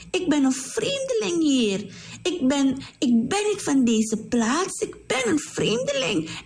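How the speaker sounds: high-pitched voice for a grateful stranger; Ruth's humble questioning. A narrator's reading. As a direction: ask it high, in wonder at the kindness. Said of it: "En ze vroeg het aan Boas".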